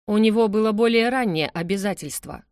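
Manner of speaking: plain reading voice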